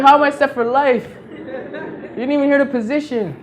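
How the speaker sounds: high, disbelieving push-back; incredulous protest. Retold or imitated. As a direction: laugh off their excitement in disbelief. He protests aunts' misunderstanding.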